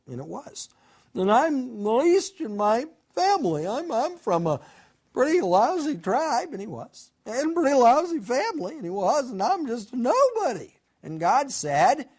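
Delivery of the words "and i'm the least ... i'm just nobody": self-deprecating protest. Acted. Gideon protests he is too lowly.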